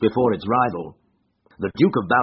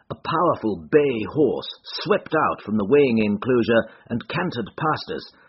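ordinary delivery, nothing marked

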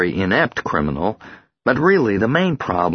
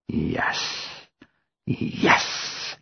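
soft unvoiced yes of relief; whispered relief; 5 semitones lower, 7 dB quieter than usual. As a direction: breathe it out, relieved. Relieved whisper after returning home.

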